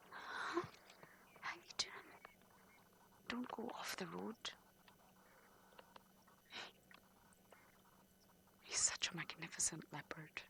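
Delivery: hushed whisper beside the animal; wildlife watching whisper. Real, not acted. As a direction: whisper so he isn't disturbed. Leopard right beside car.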